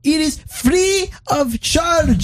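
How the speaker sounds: very high, slow, hammed-up Indian accent; pushy Indian salesman. Salesman pushes the free Samsung, in a mock Indian accent. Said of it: "and he goes".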